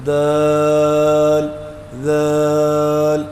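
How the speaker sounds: دال with a closed mouth, muffled a; mouth not opened. Shown as a demonstration. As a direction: say دال without opening the mouth. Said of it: "یہ منہ نہیں کھولا".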